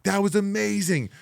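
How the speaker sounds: high, excited congratulation; mock-enthusiastic high voice; thrilled praise. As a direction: imitate gushing colleagues backstage. Others praising him after the match.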